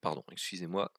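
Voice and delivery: rough, hoarse throat; speaking through a hoarse throat. Real, not acted. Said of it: "donc j'ai la voix enrouée".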